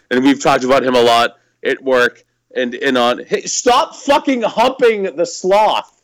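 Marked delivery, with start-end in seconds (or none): 3.2-5.9 exasperated scream. Real, not acted. Owner scolding the puppy humping a stuffed sloth, exasperated high yell. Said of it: "that's why I just screamed".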